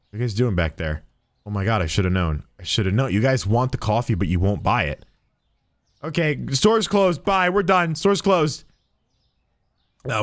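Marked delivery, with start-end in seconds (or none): none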